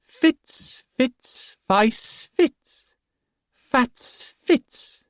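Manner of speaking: high, piping singsong; piping fool voice. A narrator's reading. The fool intoning at the boy, piping high. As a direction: pipe the name up like a strange jester. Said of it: "intoned in a piping voice".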